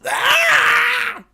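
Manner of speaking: high piercing pained shriek; monster shriek. Acted. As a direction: shriek like a wounded creature. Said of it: "and she goes".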